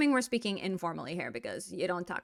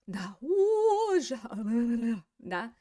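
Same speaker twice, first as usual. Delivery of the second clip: mouth on fire; much slower than usual. Pretend. Teacher acts out what spicy means, gasping mock distress. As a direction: pant as if the food burns. Miming a mouth burning from spice.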